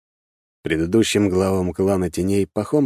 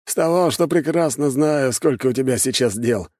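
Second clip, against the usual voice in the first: wry snort; 8 semitones higher than usual. A narrator's reading. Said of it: "хмыкнул Пахом".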